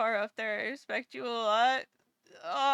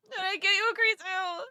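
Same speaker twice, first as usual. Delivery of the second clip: higher, fake sob; mock crying; 6 semitones higher than usual. Streamer teasing Grizu with a fake cry, mock sob. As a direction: tease with a put-on cry.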